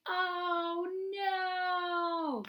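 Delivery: high, drawn-out dismayed oh no; big oh no. Shown as a demonstration. Teacher modelling the story's big oh no, high and dramatic. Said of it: "we're gonna say a big".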